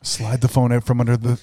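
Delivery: ordinary talk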